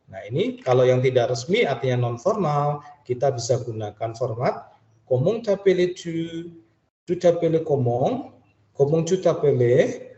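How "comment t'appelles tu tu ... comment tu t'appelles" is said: "Comment t'appelles-tu?" rising at the end like a question; rising intonation. Shown as a demonstration. Teacher shows the class the questions rise. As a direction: let the pitch climb on the last word. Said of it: "Jadi intonasinya naik ya".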